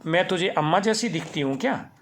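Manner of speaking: loud indignant question; angry retort. A narrator's reading. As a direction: still fuming, throw it back at him. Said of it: "गुस्सा शांत नहीं हुआ था".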